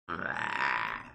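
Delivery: very low, soft groan; long low groan of exasperation; frustrated groan. Real, not acted. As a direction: let out a deep, defeated groan. Groan after upset complaint.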